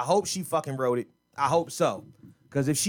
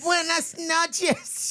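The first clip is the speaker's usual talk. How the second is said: mocking drawn-out goodbye; 12 semitones higher, much slower than usual. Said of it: "Y'all roaches".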